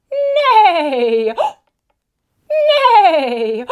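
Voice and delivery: loud whinnying horse; horse neigh. Pretend.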